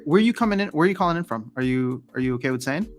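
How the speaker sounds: usual speaking voice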